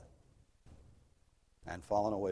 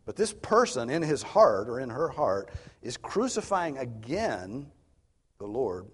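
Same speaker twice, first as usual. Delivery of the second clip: louder, raised preaching voice; forceful preaching; 5 semitones higher, 9 dB louder than usual. Acted. Preacher to congregation, accusing the heart, loud and raised. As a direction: drive home the guilt.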